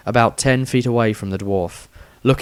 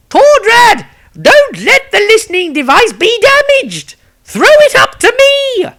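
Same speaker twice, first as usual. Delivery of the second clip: high, loud wailing character voice; Maestro shouting; 9 dB louder than usual. Acted. Maestro shouting to Tordrad from ringside. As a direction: yell across the ring in a shrill put-on voice.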